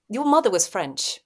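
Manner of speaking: usual speaking voice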